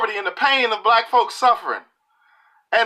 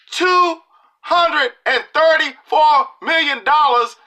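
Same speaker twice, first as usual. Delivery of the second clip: each word slow, higher and louder; outraged number; 7 semitones higher, 4 dB louder, slower than usual. Real, not acted. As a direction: spell out the sum in slow disbelief. Outraged at foreign aid sum.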